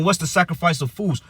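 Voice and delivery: usual speaking voice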